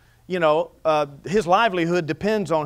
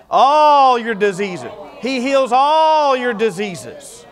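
loud, slow, drawn-out declaration; emphatic preaching; 5 semitones higher, 6 dB louder, much slower than usual. Real, not acted. Preacher proclaims healing to the congregation, loud and slow. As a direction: proclaim it with full force, every word weighed. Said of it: "You gotta know that a hundred percent".

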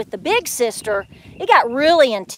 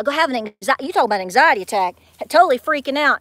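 ordinary delivery

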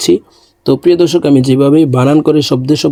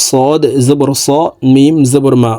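ordinary talk

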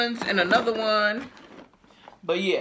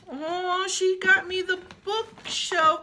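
high, slow, delighted gasp; thrilled; 13 semitones higher, slower than usual. Real, not acted. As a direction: gush over the gift. Thrilled at a gift.

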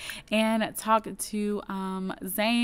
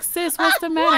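high-pitched, louder sisterly check-in; raised, urgent mock concern; acted phone greeting; 8 semitones higher, 6 dB louder than usual. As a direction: pipe up to your sister on the phone. Voices Sim answering sister's call.

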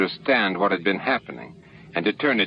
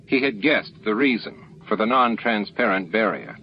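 plain reading voice

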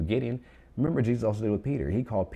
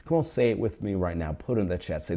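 ordinary talk

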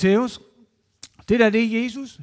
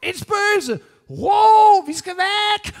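high, loud panicked shrieking; terrified scream; 13 semitones higher, 11 dB louder, much slower than usual. Acted. Frightened disciples in a storm-tossed boat, shrieking in panic. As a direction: shriek in fright like men who see a ghost. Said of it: "Så de skriger".

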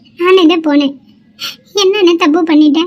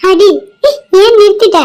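defiant cry; 4 semitones higher, 3 dB louder than usual. Acted. Sister dares brother to hit.

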